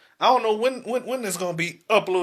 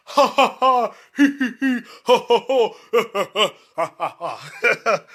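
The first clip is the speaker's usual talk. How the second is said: mock snickering laugh; 3 semitones higher than usual. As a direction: cackle like people laughing at you. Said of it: "snickering and laughing".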